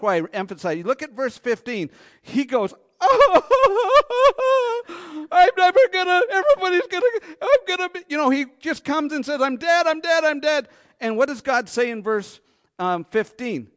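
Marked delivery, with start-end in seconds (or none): 3.0-10.7 very high, loud panicky wail; hammed-up terror; mock panic. Preacher mimics Cain's panicked wail over his punishment.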